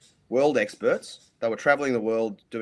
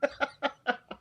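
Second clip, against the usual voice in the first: quick high laugh. Real, not acted. Guest laughing at co-host's dugong quip, high laugh. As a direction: let a high laugh escape.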